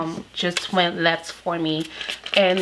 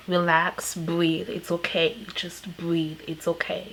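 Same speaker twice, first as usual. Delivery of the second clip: softer and softer, gentle reassurance; calm someone who can't breathe; soothing brother; 7 dB quieter than usual. Retold or imitated. Brother calming her on phone.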